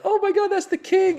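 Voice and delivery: very high-pitched awed exclamation; retold excitement. Comic reliving his first sight of the king, high excited cry. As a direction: squeal it at a royal arrival.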